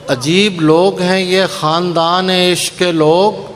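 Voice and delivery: slow, measured mushaira recitation; poetry recitation. Acted. Poet reciting ghazal to a mushaira audience, measured declamation. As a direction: recite the couplets, drawing out key words.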